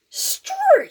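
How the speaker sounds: a high squawked Struth; startled exclamation. Acted. Dave reacting to his brother, high startled yelp. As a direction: Dave yelps in mock shock.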